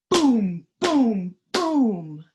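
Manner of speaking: loud booming boom, boom; drum booms. Acted. Emily's drum in the story, loud booms. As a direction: pound out each boom like a drum.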